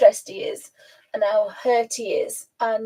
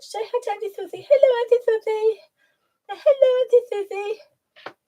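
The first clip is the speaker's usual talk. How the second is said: high, lisping pet voice; childlike high lisp; Alfie voice; 9 semitones higher than usual. Acted. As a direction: speak quickly as a little animal with a thick lisp. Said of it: "talk in the Alfie voice".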